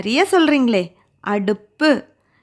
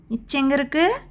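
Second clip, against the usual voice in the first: ordinary talk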